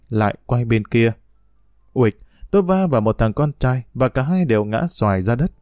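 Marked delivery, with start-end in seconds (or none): none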